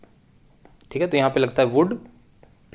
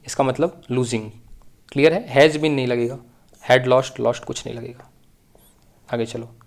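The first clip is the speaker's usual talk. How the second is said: ordinary talk, nothing marked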